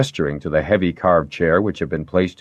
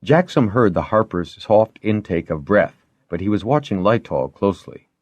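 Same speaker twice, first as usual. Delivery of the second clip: usual speaking voice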